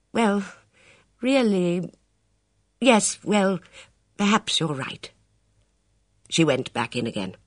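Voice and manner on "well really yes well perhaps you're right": higher, dithering middle-aged lady; a flustered lady thinking aloud; fretful lady. A narrator's reading. Lady gives in.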